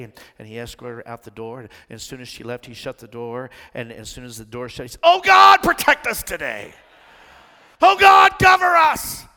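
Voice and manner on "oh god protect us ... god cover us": desperate cry to God, much higher and louder; panicked prayer. Retold or imitated. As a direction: shout it out in sudden terror once alone. Panicked prayer once door shuts.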